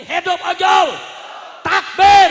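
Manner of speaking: high, full-force crowd slogan; rallying shout. Real, not acted. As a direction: belt it out to fire up the crowd. Leads crowd chant. (yel-yel lantang membakar semangat)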